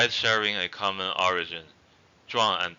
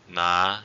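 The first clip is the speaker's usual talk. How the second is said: "na" with a slight upward glide; rising tone; 4 dB louder than usual. Shown as a demonstration. Teacher repeating the word for thick, rising tone on "na". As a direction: lift "na" gently. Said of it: "raising tone".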